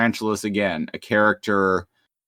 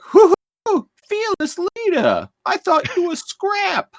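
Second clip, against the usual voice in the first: much higher, squeaky mocking voice; cheeky cartoon sidekick; 3 dB louder than usual. Co-host voices the sidekick teasing the leader, high and squeaky.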